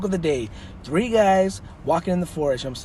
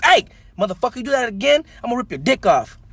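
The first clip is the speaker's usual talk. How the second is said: loud, raised-pitch mock threat; acted angry threat; 9 semitones higher, 5 dB louder than usual. Biker threatens the leprechaun, hammed-up anger. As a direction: burst out as a fed-up tough guy.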